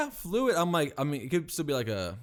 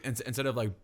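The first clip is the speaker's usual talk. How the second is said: normal talking voice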